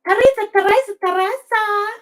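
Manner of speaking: high, loud sing-song name call; excited calling out. Real, not acted. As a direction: sing her name out like announcing a winner.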